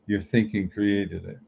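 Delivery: usual speaking voice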